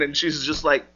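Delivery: usual speaking voice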